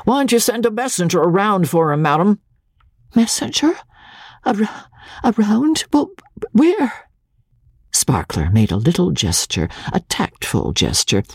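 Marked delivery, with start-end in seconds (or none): 0.0-2.4 put-on booming old clerk. A narrator's reading. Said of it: "in a voice that seemed to echo". 3.1-7.1 high, breathless stammering plea; nervous bride asking the clerk for help; timid young wife. A narrator's reading. Magnolia bewildered.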